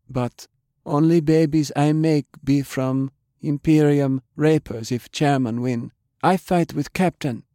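high, small halting voice; tiny voice. A narrator's reading. Small woman speaks up from the back of the room, tiny and timid. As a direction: speak up timidly in broken English. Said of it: "a tiny voice from the back".

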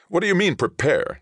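sharp, high and loud, biting off the question; angry snap. A narrator's reading. Colonist Dion snapping at the envoy, high and loud. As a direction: snap it back in outrage. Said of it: "Dion snapped".

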